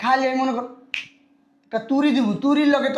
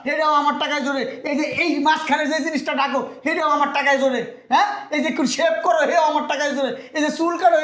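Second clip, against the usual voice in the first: higher-pitched, relentless berating; angry tirade; 4 semitones higher than usual. Acted. Father-in-law listing all he pays for, berating son-in-law. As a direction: rant on indignantly in a raised pitch.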